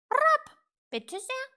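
very loud, high, huffy squawk; offended raven croak. A narrator's reading. Raven rebuffed by little witch, offended croak. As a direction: croak it out, insulted. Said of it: "krächzte Apraxas beleidigt".

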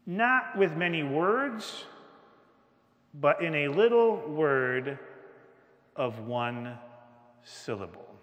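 slow and drawn out, "not" leaned on; slow emphasis. Shown as a demonstration. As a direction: savour each word of the surprise.

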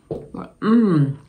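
ordinary delivery

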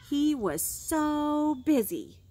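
slow, each word leaned on; slow emphatic refrain. Shown as a demonstration. Teacher modelling the refrain for kids, slow and emphatic. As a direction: model the refrain slowly for the child to copy. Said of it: "You're going to say over and over again".

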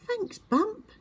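high, puzzled and innocent; confused little owl voice. A narrator's reading. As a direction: stammer it in wonder. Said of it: "Twit cried".

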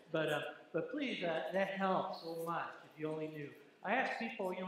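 usual speaking voice

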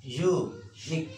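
"Unique" slowly, split in two; syllable by syllable. Shown as a demonstration. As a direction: pause between "U" and "nique" in "Unique". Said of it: "here the stress".

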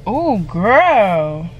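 ordinary talk

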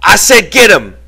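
high, loud yelled order; insistent yell. A narrator's reading. George orders Lenny to fight back, insistent yell. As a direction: yell the order, demanding to be obeyed. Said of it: "George yelled again".

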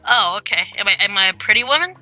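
normal talking voice

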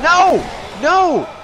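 high, loud cries of no; frustrated shouts. Real, not acted. Player seeing the kill go wrong, dismayed shouts. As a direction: cry out in dismay, each one separate.

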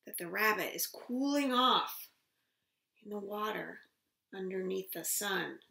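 lively storybook voice, high and loud; reading to a toddler. Acted. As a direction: read it big for little ones. Reads picture book aloud.

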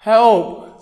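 loud high cry for help; frantic mock call for help; shout for help. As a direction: yell for someone to help. Skit, calling for help.

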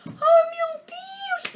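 very high, loud and drawn-out squeal of joy; ecstatic scream. Retold or imitated. Rita hearing her ticket bought, ecstatic squeal. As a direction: shriek it like the best news ever. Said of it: "a Rita ficou logo tipo".

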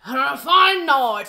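manly pirate voice; slow, growled pirate storyteller. Acted. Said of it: "manly pirate voice".

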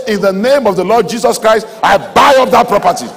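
preacher leads a confident shout; bold declaration. Shown as a demonstration. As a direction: claim it out loud for the congregation.

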